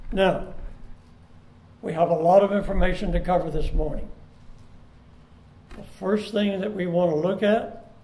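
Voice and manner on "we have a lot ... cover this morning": ordinary delivery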